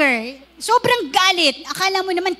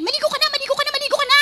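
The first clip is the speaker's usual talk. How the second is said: higher, repetitive nag ending in a shout; nagging wife; 8 semitones higher, much faster than usual. Retold or imitated. Nagging wife telling husband to bathe, rising to a shout. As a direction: nag like a broken record, then yell. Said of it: "Paulit-ulit, parang robot".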